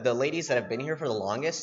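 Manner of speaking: usual speaking voice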